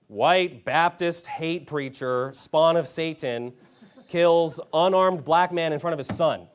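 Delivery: slow, emphatic mock headline read; deliberate headline voice, phrase by phrase; news headline. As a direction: announce it like a sensational headline. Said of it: "I love the news headlines".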